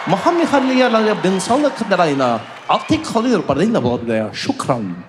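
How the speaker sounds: hammy pretend Arabic safety video; mock Arabic gibberish. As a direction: fake Arabic announcement, rapid and rhythmic.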